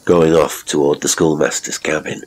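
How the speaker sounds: plain reading voice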